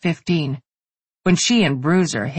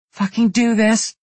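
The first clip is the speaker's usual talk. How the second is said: higher, louder urging; urgent encouragement; 6 semitones higher, 3 dB louder than usual. A narrator's reading. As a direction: push her hard. Friend urging her on.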